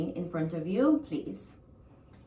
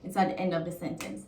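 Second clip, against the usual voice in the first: usual speaking voice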